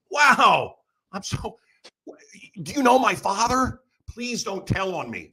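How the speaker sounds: high-pitched mock-innocent pleading; overly nice. Answering a driver's taunt about Daddy, overly nice. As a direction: play the worried child, sweetly polite. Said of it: "my new thing that I do is I'm overly nice".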